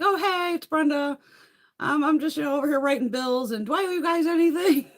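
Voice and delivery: high, sing-song caller voice; chirpy phone voice. Acted. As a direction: put on a breezy, innocent phone manner. Acts out her phone call.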